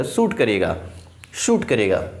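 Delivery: शूट said low, with a long "oo"; शूट stressed and falling; correct word. Shown as a demonstration. Teacher corrects shot to शूट for students. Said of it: "ये शॉट नहीं शूट है".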